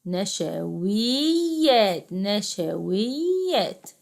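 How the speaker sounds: نشويات with a greatly stretched ee after w; long yaa. Shown as a demonstration. Teacher showing pupils a madd yaa, long drawn vowel. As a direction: draw out the wii in نشويات slowly. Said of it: "كلمة فيها مد ياء".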